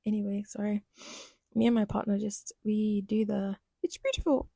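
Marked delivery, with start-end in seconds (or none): 3.8-4.5 put-on much higher, breathy kid voice; mock tearful child; the it's beautiful voice. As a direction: squeak it like the little boy.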